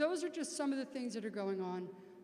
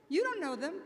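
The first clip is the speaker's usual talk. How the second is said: loud, higher-pitched Queens accent; 5 semitones higher, 7 dB louder than usual. Shown as a demonstration. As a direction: hammer it home in Queens dialect. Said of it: "say it in my best Queens accent".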